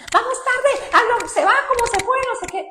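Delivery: irritated hurry-up rant; impatient rushing. Retold or imitated. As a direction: scold someone for being late. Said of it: "lo que hacemos normalmente es".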